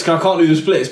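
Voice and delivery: usual speaking voice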